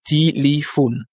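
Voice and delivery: téléphone very slow: ti-li-FON; téléphone drawn out, E's said as I; slow syllable by syllable. Shown as a demonstration. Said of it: "comme des I".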